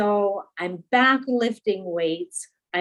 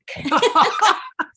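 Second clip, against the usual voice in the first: bright relieved laugh, higher; happy laugh; 8 semitones higher, 3 dB louder than usual. Real, not acted. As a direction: laugh out with joy. Happy laugh after good news.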